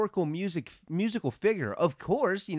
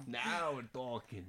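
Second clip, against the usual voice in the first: mock echo; 2 semitones lower, 9 dB quieter, slower than usual. Echoes friend's phrase, drawn out.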